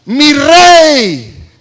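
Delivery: drawn-out hail to a monarch; calling out. Acted. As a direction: shout to the king from afar. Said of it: "le gritó".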